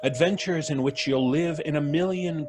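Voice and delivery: put-on grand old radio announcer read; announcer intro. Announcer opening the radio drama, grand and sweeping. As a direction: sweep it out like a sci-fi serial opener.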